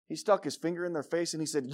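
normal talking voice

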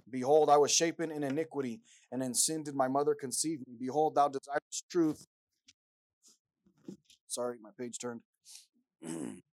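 loud, rising preached psalm; a preacher crying out the psalm; impassioned scripture reading. Acted. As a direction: build the prayer to full force. Reads Psalm 51 aloud.

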